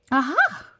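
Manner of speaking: loud, high aha of discovery; triumphant eureka cry; eureka shout. Acted. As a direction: burst out with the idea. Sudden idea in story.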